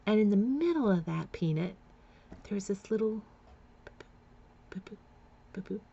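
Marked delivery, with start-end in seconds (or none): 3.8-5.8 soft clipped boops like a tiny heartbeat; imitated heartbeat blips. Shown as a demonstration. Describing the ultrasound screen, mimicking the tiny heartbeat with soft boops. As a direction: tap out a heartbeat with your lips. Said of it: "imitating a heartbeat".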